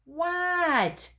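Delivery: high Froggy voice. Acted. Froggy answers Dad.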